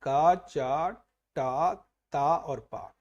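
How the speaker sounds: each syllable drawn out very slowly; teacher reciting sound groups for students; slow recitation. Shown as a demonstration. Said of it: "पांच वर्गों में बांटते हैं".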